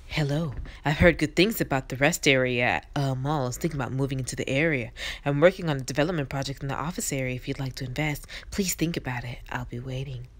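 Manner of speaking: low, soft seductive murmur, whispered at the end; sultry hush. Pretend. Gamer voicing a flirty investor, low seductive murmur. Sultry voiced game character.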